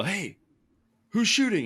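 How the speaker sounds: high-pitched retold holler; imitated yell. Hunter calling through the woods to an unseen shooter, imitated yell. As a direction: call it out high, as if across the woods. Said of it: "I hear someone yell".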